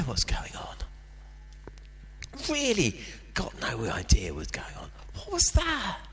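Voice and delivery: hushed, awed whisper. Real, not acted. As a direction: breathe it out, baffled. Bewildered at the experience.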